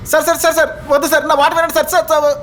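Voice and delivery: high-pitched frantic begging with a nervous laugh; squeaky scared pleas to a bully; panicked pleading. Acted. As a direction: beg in rising panic, half laughing from nerves. Begging not to be hurt.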